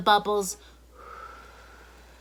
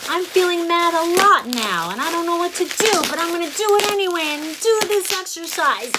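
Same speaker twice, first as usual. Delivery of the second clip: higher, louder, rushing frustrated venting; mock angry outburst; 7 semitones higher, 5 dB louder than usual. Shown as a demonstration. Teacher voicing a child, hammed-up frustrated venting. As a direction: act out a kid fed up with being mad. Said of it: "project it out".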